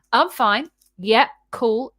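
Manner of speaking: put-on moody girlfriend saying she's fine; sulky fine. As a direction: pretend everything is fine, coldly. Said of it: "this attitude".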